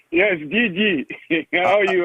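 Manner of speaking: greeting while still chuckling; laughing. Real, not acted. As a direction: talk through the giggles. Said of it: "I can't stop laughing whenever I speak to you".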